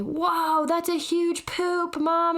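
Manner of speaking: put-on high, slow little-kid voice; wide-eyed toddler marveling at a poop; four-year-old's voice. Mother imitating her four-year-old, high and slow. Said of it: "and she would say".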